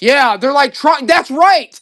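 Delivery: loud, high-pitched burst of sudden recognition; excited agreement. Real, not acted. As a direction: excitedly confirm they guessed it, voice jumping up high. Seller excitedly agrees with viewers' guess.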